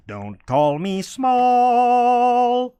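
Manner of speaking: slow, high, loud drawn-out cry; dramatic shout. Acted. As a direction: belt it out like a wounded hero. Comic outburst about being small.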